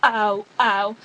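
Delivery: loud, repeated yelps; retold loud ow; mock pained yelp. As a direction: cry out as if jabbed, acting it out. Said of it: "and then I was like".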